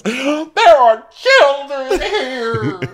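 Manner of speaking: piercingly high, slow, drawn-out wail; hammed-up outraged screech, every word stretched; mock screaming. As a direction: imitate a scandalized mother shrieking. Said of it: "keeps screaming".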